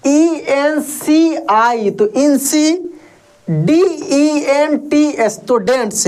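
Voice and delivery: slow, split into syllable chunks; syllable by syllable, slowly; broken into parts. Shown as a demonstration. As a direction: break the word apart slowly for a learner. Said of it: "इसको दो part में तोड़कर पढ़ सकते हैं".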